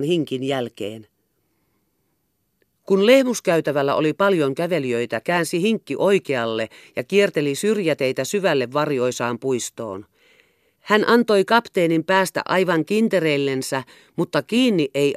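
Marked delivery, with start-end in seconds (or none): none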